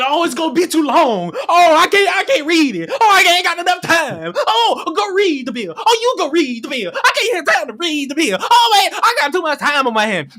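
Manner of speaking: high whiny mock voice of lazy streamers; a mocking, whiny impression of streamers; mocking whiny complainers. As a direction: whine like someone who can't be bothered. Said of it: "all I've seen from people is that".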